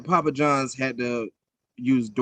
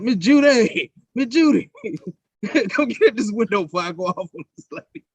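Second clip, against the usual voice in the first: high, loud call across the restaurant; calling for help; 9 semitones higher, 5 dB louder than usual. Retold or imitated. Worker calling Miss Judy to the window, loud and laughing. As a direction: yell for the manager, laughing, half-panicked. Calling manager over in retold story.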